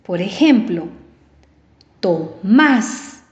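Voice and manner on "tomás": "Tomás" with a short TO and a punched MÁS; stress on last syllable. Shown as a demonstration. Teacher gives an aguda ending in S, "Tomás" with MÁS stressed. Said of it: "lleva la fuerza de voz en la última sílaba".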